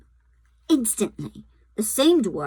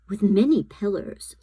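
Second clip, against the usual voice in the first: usual speaking voice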